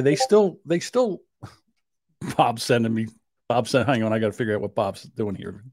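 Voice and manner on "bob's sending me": usual speaking voice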